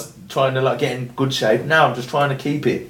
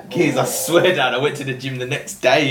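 normal talking voice

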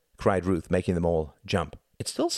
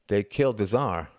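firmer, more audible statement; speaking up; 3 semitones higher than usual. A narrator's reading. Tom told to speak up, speaking clearly. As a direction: state it clearly so he can hear. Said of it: "Speak up".

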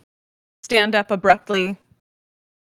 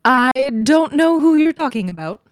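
loud, higher-pitched, openly false denial; barefaced lie; 4 semitones higher, 6 dB louder than usual. Acted. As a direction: deny it without bothering to hide the lie. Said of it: "not trying to be, to, like, hide that it's a lie".

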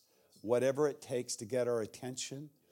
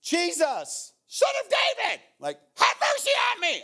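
desperate shout for mercy, high and loud; crying out; 6 dB louder than usual. Acted. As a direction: beg at the top of your lungs. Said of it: "he began to cry out and say".

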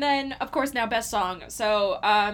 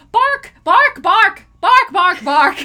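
loud, high-pitched yapping of the word bark; over-the-top shouted barks, much higher than speech; 9 semitones higher, 14 dB louder, slower than usual. Retold or imitated. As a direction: belt out each bark like an excited poodle. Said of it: "every time [name] goes".

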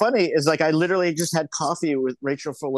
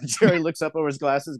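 ordinary talk

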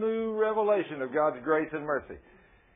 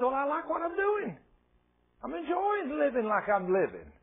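high-pitched, mock carefree protest; defiant brush-off; 8 semitones higher than usual. Isaiah defending his life to God, high-pitched defiance.

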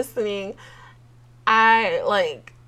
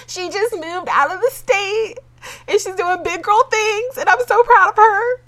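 much higher, tearful and proud; crying with pride; 13 semitones higher, 5 dB louder than usual. Real, not acted. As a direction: sob out your pride in her.

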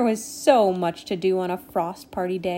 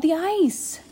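high, urgent raised voice; calling after her; 9 semitones higher than usual. A narrator's reading. Nimbus warns Rainy about the ice, calling out. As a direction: call out a last warning. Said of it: "he called".

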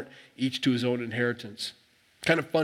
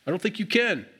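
higher-pitched, skeptical prodding; challenging; 5 semitones higher than usual. Retold or imitated. Joshua testing the people's promise, challenging. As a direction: lean in and give them a hard time. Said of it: "he's kinda giving them a hard time".

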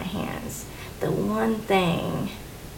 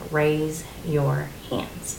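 very slow, measured command; voice of God; 3 semitones lower, much slower than usual. Retold or imitated. Woman retelling how God spoke to her in church, voice of God, very slow. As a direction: give the words slowly, one by one, as God would. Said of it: "God just spoke to me".

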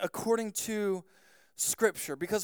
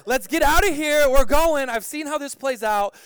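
loud, high acted urgency; comic shouted retreat, easing at the end; urgent alarm; 6 semitones higher, 9 dB louder than usual. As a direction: call out to flee right now. Retold urgent flight from danger.

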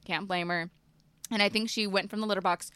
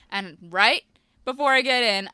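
ordinary talk

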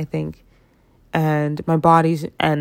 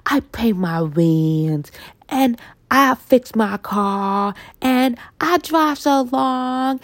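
whiny self-justifying; 4 semitones higher, much slower than usual. Retold or imitated. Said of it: "I'm like".

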